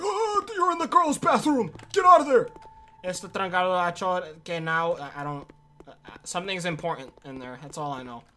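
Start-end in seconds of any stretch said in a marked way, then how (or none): 0.0-2.5 high, loud mock scold. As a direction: yell it like a shocked attendant. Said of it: "It's like".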